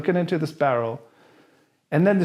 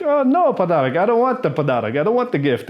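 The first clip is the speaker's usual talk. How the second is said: cheerfully misunderstand the gift; playing dumb tourist; 4 semitones higher, faster than usual. Comic misreading the gift demand, high and quick. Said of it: "I'm like".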